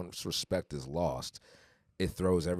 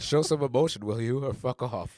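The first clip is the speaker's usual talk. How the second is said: raised, louder mock reprimand; Chef Ramsay; 5 semitones higher, 5 dB louder than usual. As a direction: bark it like an exasperated TV chef, half laughing. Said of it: "to quote Chef Ramsay".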